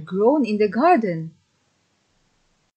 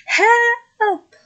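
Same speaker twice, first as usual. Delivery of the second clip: a much higher, louder yell; shout for help; 7 dB louder than usual. Acted. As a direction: cry out to his mother from the beanstalk. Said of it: "he shouted".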